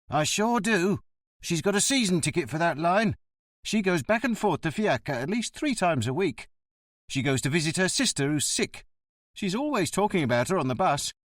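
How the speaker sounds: surly bus driver. Acted. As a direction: play the grumbling ticket man.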